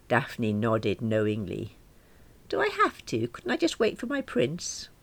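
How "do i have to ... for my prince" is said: put-on high, plaintive, childlike plea; whiny pleading. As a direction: beg them like a sulky girl. Alice pleading with friends.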